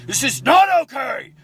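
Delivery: high, strained yell of frustration; raging shout. Retold or imitated. Angry shout at wife who tries to calm him. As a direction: shout it like everything just broke. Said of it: "I'm saying that out of rage and frustration".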